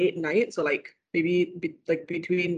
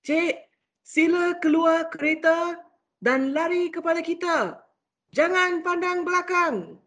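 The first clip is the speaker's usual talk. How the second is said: higher, urgent officer's orders in Malay; police officer; 9 semitones higher than usual. Retold or imitated. Police officer ordering the wife to run, urgent. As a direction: order her out of the car urgently. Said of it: "police was like".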